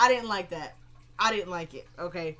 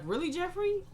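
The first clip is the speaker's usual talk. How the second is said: high, rising disbelief; acted-out "oh, really?" incredulity; incredulous retort; 9 semitones higher than usual. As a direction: question him in a much higher voice. Acted retort in retold argument.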